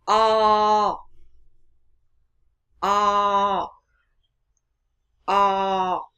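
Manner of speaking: slow open o, mouth wide; short o vowel. Shown as a demonstration. Teacher repeating the short o for students. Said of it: "Miệng mở rộng".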